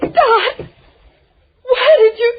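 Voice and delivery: tearful. Acted. After Dot is struck.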